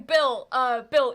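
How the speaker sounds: loud call for Bill; shouting. Acted. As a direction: shout out a name urgently. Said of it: "as this guy's shouting".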